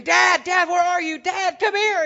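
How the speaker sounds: put-on boy woken by thunder screaming for his dad; frightened child screaming. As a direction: scream for your father like a scared little boy. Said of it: "he started screaming".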